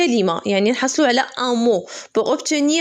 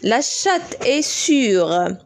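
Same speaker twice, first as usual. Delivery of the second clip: slow dictation read; much slower than usual. Shown as a demonstration. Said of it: "teacher reads sentence slowly".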